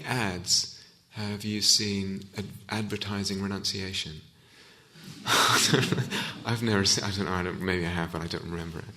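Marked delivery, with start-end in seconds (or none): 5.0-6.4 real, unvoiced burst of laughter; amused laugh at one's own question. Real, not acted. Teacher to listeners after asking about ads advertising renunciation, amused laugh.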